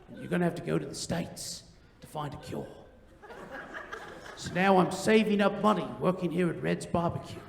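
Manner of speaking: low, hushed, grave confession; dark sob story. Acted. Young driver telling a customer about his sick mom, darkly solemn. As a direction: tell it softly and sorrowfully, pitched well down. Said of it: "darkest story I could think of".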